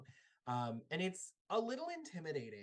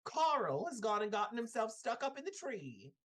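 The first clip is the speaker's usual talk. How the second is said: put-on high, fussy old woman's voice; old lady; 9 semitones higher than usual. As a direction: tease the child about her cat.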